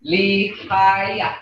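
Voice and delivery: "Lihaya" broken into three beats; three separate syllables. Shown as a demonstration. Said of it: "that's three syllable sound".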